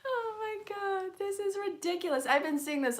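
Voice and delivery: high astonished oh my God; amazed disbelief. Real, not acted. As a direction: squeal it in happy exasperation. Same card appears again.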